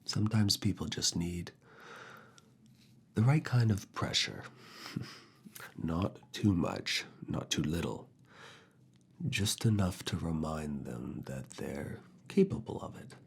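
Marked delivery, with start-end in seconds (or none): none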